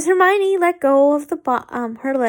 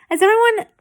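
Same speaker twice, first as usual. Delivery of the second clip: higher, louder slip; high false start; 9 semitones higher, 4 dB louder, much faster than usual. Real, not acted. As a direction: blurt it high then restart. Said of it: "um, as everyone".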